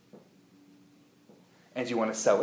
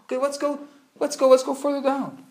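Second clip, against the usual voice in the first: ordinary talk, nothing marked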